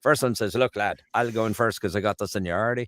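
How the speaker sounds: broad Newfie storyteller's accent; Newfoundland accent. Shown as a demonstration. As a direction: spin a yarn like a Newfoundlander. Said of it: "jump the pond over to Newfoundland".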